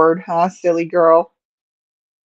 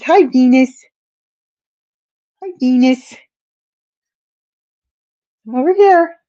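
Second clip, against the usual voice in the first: much higher, sing-song call of her name; her high greeting voice; 7 semitones higher than usual. Retold or imitated. She greets the cat Venus in a high coo. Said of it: "She says".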